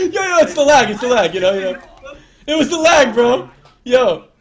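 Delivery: loud, high, pleading excuse; real loud excited protest. Real, not acted. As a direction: insist it was the lag, laughing. Excusing accidental kill.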